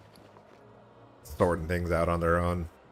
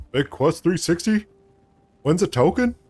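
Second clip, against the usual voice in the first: excited callout; 10 semitones higher, 6 dB louder than usual. Real, not acted. Streamer greeting the giveaway winner, loud excited callout. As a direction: call it out like a stadium announcer.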